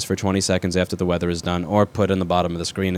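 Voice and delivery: flat, lifeless newsreader drone; monotone voice. Shown as a demonstration. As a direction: drone it like a dull anchor. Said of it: "in a monotone voice like this".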